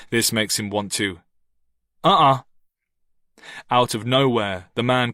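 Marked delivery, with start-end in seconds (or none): 2.0-2.4 loud warning no; sharp refusal. A narrator's reading. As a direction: cut him off sharply. Ricky stops him.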